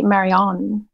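Marianne said as mair-ee-AHN; goddessy pronunciation. Shown as a demonstration. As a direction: open the end of Marianne into "ahn". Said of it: "it's very goddessy".

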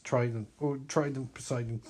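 ordinary talk

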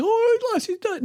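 put-on high wailing child; crying sister. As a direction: wail like a heartbroken little sister. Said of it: "my big sister".

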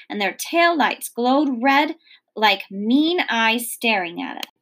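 plain reading voice

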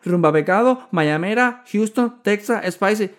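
high, loud rattling list; fast-talking server. Retold or imitated. Server rattles off soda options. (dependienta recitando opciones, aguda y fuerte)

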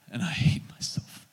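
whispered, anguished retold confession; hushed confession, all breath; whispered self-loathing. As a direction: whisper it as a painful admission. Acts out man's anguished confession.

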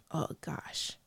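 whispered, choking up; barely holding back tears; about to cry. Real, not acted. Tearing up while telling her husband about the midwife. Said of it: "I'm about to cry".

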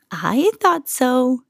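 slow, knowing, smiling voice. A narrator's reading. Old woman pleased by Camilla's confession, smiling. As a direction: draw it out with an audible smile. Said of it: "said with a smile".

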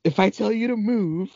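high, bossy reporter barking orders. Retold or imitated. Mocking the reporter bossing her cameraman, high bossy bark. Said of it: "She was like".

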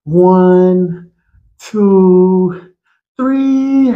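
put-on high counting like a child; lightly voiced kid counting; imitating the kid. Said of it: "that one kid who says".

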